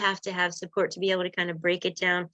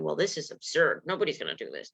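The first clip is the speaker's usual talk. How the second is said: low, dismissive grumble, much lower; a viewer scoffing, voice dropped low; mock skeptic; 5 semitones lower, 3 dB quieter, faster than usual. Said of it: "you might be going".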